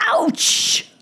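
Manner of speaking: sudden high-pitched "ouch"; mock pained yelp. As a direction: yelp as if stung by the words. Mock pain at a stinging word.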